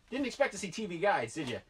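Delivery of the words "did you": ordinary delivery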